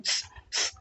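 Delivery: soft unvoiced hiss through teeth. Shown as a demonstration. Teacher demonstrates the half स hiss. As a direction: hiss like shooing a cat. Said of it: "उसको ऐसे करके डांटते हैं ना".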